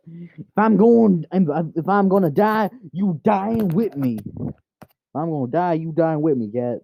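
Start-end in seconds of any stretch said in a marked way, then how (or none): 0.1-4.2 melodramatic threat. Pretend. Owner vows to take the cat along, melodramatic.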